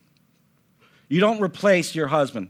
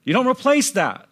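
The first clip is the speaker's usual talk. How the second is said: emphatic; 10 semitones higher, 5 dB louder than usual. Real, not acted. Preacher's climax after grandfather story.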